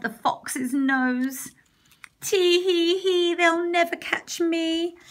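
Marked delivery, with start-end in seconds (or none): 2.2-5.0 gleeful cry. Acted. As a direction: crow with glee atop the fox's nose. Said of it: "he cried".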